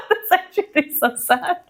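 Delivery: high laughing through the words. Real, not acted. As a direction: giggle while calling it sad. Laughing at her own joke.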